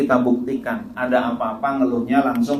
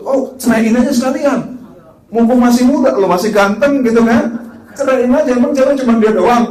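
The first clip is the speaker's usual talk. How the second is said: provocateur friend; 10 semitones higher, 7 dB louder than usual. Retold or imitated. Provocateur friend urging divorce.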